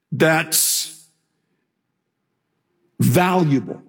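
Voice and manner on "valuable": ordinary talk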